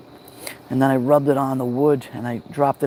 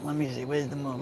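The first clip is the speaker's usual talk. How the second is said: muttered to himself; 12 dB quieter than usual. Real, not acted. Muttering to himself, searching sky.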